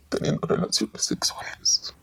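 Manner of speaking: hushed, unvoiced, almost ashamed; whispered. Real, not acted. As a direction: drop to a whisper on the shameful part. Mother lowers voice on shame. (susurrado con vergüenza)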